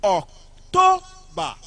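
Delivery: "October" with TO high and loud; second-syllable stress. Shown as a demonstration. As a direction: punch the middle syllable of "October". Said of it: "on the second syllable".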